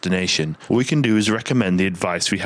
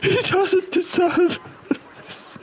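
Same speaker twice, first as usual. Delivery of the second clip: falsetto sobbing mother; slower than usual. Acted. Repeat of mother's line.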